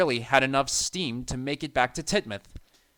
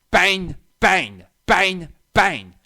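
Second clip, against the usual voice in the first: banging noise; 5 semitones higher, 10 dB louder, much slower than usual. A narrator's reading. Monster at doors.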